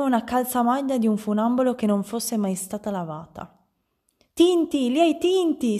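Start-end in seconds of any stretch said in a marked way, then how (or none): 4.3-5.8 high, loud accusing cry; shrieking. A narrator's reading. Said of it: "strillò la madre". (strillo accusatorio)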